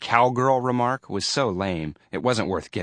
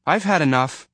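high, loud, fed-up protest; impatient; 8 semitones higher, 5 dB louder than usual. A narrator's reading. Roy wanting the bully to let go, impatient. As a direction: protest impatiently with raised pitch. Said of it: "Roy said impatiently".

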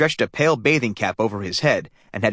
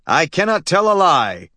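higher, louder, grand declaiming father; solemn intoning; 6 semitones higher, 4 dB louder than usual. A narrator's reading. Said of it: "intoned his father".

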